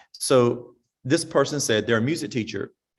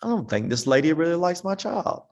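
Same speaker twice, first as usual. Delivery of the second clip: doubtful mother; 6 semitones higher than usual. Retold or imitated. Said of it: "this mama's thinking".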